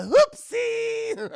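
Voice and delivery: high, loud gleeful squeal; a villain delighting in the chaos; mock Joker. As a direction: make it a childish oops. Joker runs people over.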